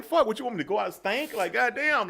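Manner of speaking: high-pitched, put-upon comeback; mock exasperated protest. Acts out exasperated reply to wife.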